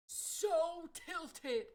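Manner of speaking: loud and high, fed-up exclamation; exclaimed. Real, not acted. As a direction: blurt it out annoyed. Loud repeat of tilted.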